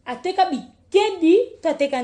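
sudden loud call out. Real, not acted. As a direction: call it out sharply. (kobelela makasi)

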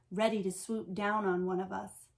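plain reading voice

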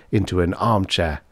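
ordinary delivery, nothing marked

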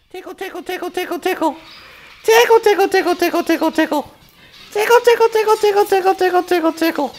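very high playful squeak. Real, not acted. Tickling the parrot.

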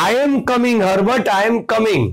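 mild mock calling out. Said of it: "she cried loudly".

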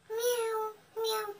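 high mock meowing; cat meowing to her owner. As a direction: mew like a begging cat.

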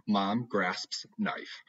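usual speaking voice

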